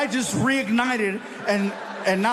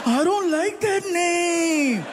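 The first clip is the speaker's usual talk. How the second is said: much higher, slow sulky whine; whiny kid; 9 semitones higher, much slower than usual. Retold or imitated. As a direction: drag it out like a sulking kid. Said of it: "Frankie's like".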